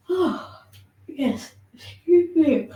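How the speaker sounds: high yawn breaking into an apology. Real, not acted. Teacher yawning and apologising to her class. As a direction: yawn it out, then excuse yourself. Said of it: "apologies for the yawns".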